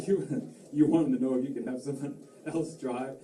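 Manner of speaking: imitating a clerk laughing through words; stifled laughter. Insurance agent asking caller about policy, failing to hold back laughter. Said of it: "the guy literally does this".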